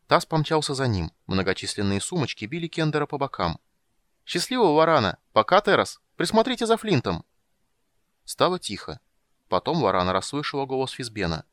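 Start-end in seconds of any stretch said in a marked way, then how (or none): 4.2-7.2 high, loud cheerful farewell shouts; called farewell. Acted. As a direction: yell goodbyes over the shoulder while running. Tas shouting farewells running off. (громко и радостно кричит на прощание)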